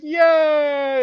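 high, loud cheering "Yay"; mock cheer. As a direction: cheer like a crowd. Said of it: "everyone's like".

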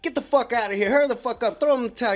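loud, high barked orders; yelling guard. Retold or imitated. Juvenile hall staff rushing naked kids through showers, yelling. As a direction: bark them through the shower.

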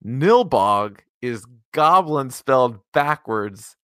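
ordinary talk, nothing marked

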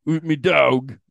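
hammed-up Cockney show-off; Cockney British. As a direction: put on a broad, silly Cockney accent. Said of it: "Cockney British?".

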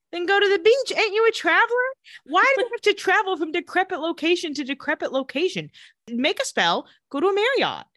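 high, loud, incredulous scolding; exasperated rant. Real, not acted. Host scolding the travelers for bad choices, loud exasperated rant. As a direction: berate them like they're idiots. Ranting at characters on screen.

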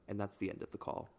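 ordinary talk

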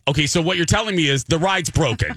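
mock-frustrated demand; exasperated. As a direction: plead as a fed-up customer, retold for laughs. Retold exasperated guest arguing back.

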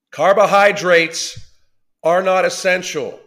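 loud, slow, every word hammered out; emphatic. Real, not acted. Ranting at a commenter, loud and slow with heavy emphasis. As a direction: pound home to the viewers that carbohydrates are not essential. Angry rant about nutrition.

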